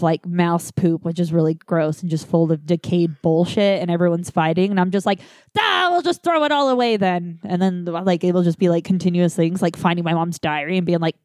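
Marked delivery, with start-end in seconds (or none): 5.6-7.4 higher, fed-up give up; high exasperated shrug. Retold or imitated. Giving up amid the family fight, high exasperated. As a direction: throw up your hands in the voice.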